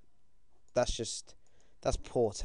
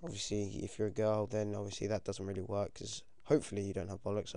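usual speaking voice

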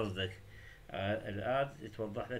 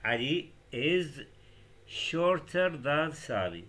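very slow, word by word, a bit louder; slow model sentence; 3 semitones higher, 4 dB louder, much slower than usual. Shown as a demonstration. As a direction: spell the sentence out slowly for learners. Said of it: "إذا بدنا نقول علي أقصر من سامي". (نطق بطيء جدًا للجملة)

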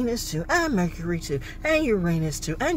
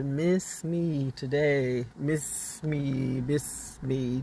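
slow emphatic; 4 semitones lower, 7 dB quieter, much slower than usual. Acted. Drawn out emphatic phrase.

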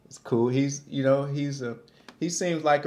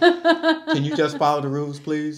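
mock plea; 4 semitones higher, 5 dB louder than usual. Mock pleading producer.

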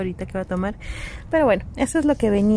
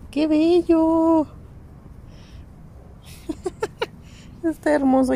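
gushing admiration; 7 semitones higher than usual. Real, not acted. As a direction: swoon over the cute toy. Admiring a toy.

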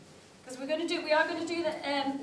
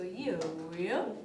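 swooping yo-yo pitch; 6 semitones lower than usual. Shown as a demonstration. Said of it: "we're just gonna yo-yo our voice".

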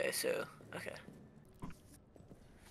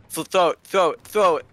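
louder and higher with each call; shouting; 8 semitones higher, 12 dB louder than usual. Real, not acted. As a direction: scream at them to throw. Urgent combat calling out.

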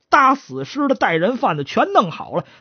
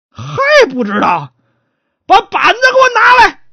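threatening magistrate; 7 dB louder than usual. Acted. Magistrate calling for the board to beat him, furious. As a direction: bellow for the punishment.

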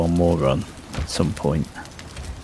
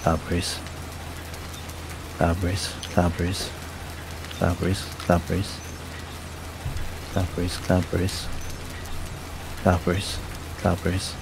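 softer, lightly odd pickup voice; weird voice; 9 dB quieter than usual. Shown as a demonstration. Player voicing each berry picked up, softly weird voice. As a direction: repeat it softly, as a running gimmick. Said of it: "say in my weird voice everything that I pick up".

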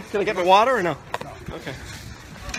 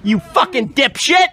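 real angry yell, pitched high; furious shout; 12 semitones higher, 7 dB louder, slower than usual. Real, not acted. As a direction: shout it in outrage at the officer. Furious argument with an officer.

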